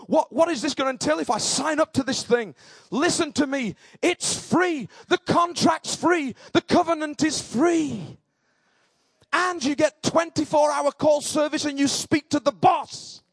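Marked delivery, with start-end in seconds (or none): 2.9-13.2 high, emphatic gospel proclamation; impassioned preaching. Real, not acted. Preacher declaring the covenant free to all, with fervour. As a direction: drive each phrase home to the congregation.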